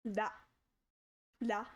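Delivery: "La" with the tongue tip tapping the hard palate; L sound demo. Shown as a demonstration. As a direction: tap the tongue for "La". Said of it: "And then it goes down".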